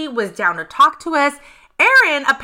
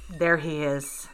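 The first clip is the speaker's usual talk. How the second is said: hushed reveal; 8 semitones lower, 9 dB quieter than usual. Real, not acted. Building suspense reveal.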